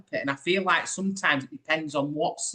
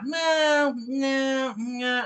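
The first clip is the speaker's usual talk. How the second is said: dismissive denial; 10 semitones higher, much slower than usual. Retold or imitated. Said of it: "She was like".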